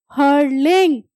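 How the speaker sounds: हर्लिंग said with no T sound; hurling with silent t. Shown as a demonstration. Teacher models हर्लिंग for students, T silent. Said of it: "T is silent".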